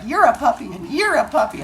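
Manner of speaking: put-on high playful kid voice; silly kid. Teacher voices Ricardo patting kids' heads, high playful kid. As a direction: coo it like a goofy child. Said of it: "He's like".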